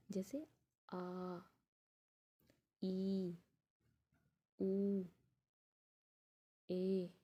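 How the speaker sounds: each vowel held long and slow; long Hindi vowels, held; drawn-out long vowels. Shown as a demonstration. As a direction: stretch every vowel out slowly for the class. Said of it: "इनको हम थोड़ा time लेकर बोलते हैं".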